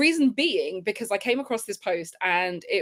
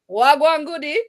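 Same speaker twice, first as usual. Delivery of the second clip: louder, higher attempt at Jamaican Patois; imitated Jamaican Patois; 5 semitones higher, 5 dB louder than usual. Kiwis learning Patois from Shelly-Ann, imitated Jamaican accent. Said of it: "teaching them patois".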